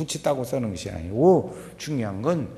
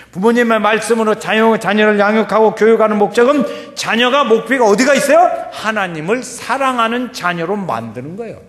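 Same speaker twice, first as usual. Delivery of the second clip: loud, high-pitched sermon delivery; preaching; 8 semitones higher, 10 dB louder than usual. Real, not acted. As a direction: preach it out forcefully to the congregation. Preaches at full force. (힘주어 외치는 설교)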